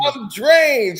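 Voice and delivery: mock exhausted outburst.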